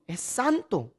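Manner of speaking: normal talking voice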